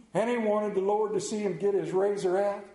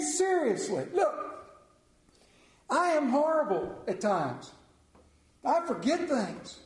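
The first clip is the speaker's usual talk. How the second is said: usual speaking voice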